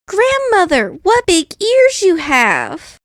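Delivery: put-on high, wondering little girl; a girl marvelling at her granny; the girl in bed asking her grandmother, high and amazed. A narrator's reading. Girl voiced in story.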